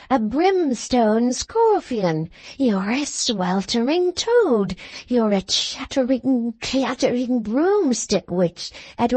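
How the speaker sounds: put-on slow, wheezing old man's scolding, words dragged out; gasping old man. Old man cursing his wife after flinging the cushion, gasping. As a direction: drag out each insult as a winded, cross old grandfather. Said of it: "gasps the old man".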